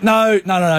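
high-pitched apologetic protest; hasty apology. Retold or imitated. Bitten woman reassures the lady, hastily apologetic.

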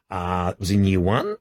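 usual speaking voice

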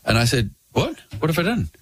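low, firm barman warning; stern barman. Retold or imitated. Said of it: "he goes".

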